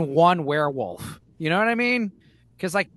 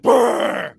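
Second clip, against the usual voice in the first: loud, mock monster roar; werewolf roar; 4 semitones higher, 7 dB louder than usual. Werewolf spotting someone at the trash can, imitated roar. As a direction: roar like a startled beast, playfully. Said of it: "and just be like".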